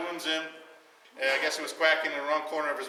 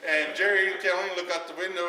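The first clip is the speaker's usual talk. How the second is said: usual speaking voice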